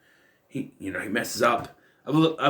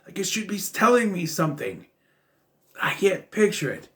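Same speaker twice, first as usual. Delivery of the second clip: slightly worked-up, higher-pitched puzzlement; puzzled recognition; 7 semitones higher than usual. Retold or imitated. Trying to place a voice heard in a film, puzzled recognition. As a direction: lift the pitch as if straining to place a voice. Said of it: "I'm like".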